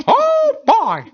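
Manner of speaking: very high squeak breaking into a laugh; Mickey Mouse voice. Retold or imitated. Imitating Mickey Mouse at work, laughing. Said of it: "Mickey Mouse".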